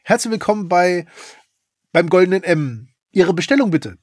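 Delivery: drive-in voice taking orders; drive thru speaker. Pretend. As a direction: announce it like a fast-food intercom. Drive-in-Bestellung gespielt. (Drive-in-Lautsprecherstimme)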